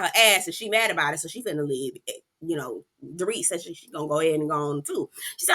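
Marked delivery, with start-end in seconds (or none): none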